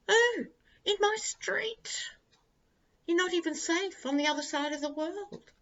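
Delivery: hammed-up horrified whisper at the MAGA hat; mock shock. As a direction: gasp it out in fake alarm, voice way up.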